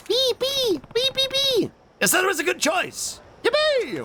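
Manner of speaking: very high squeak; high squeaky chirps; squeaky cute voice. Pretend. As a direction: squeak like a tiny creature.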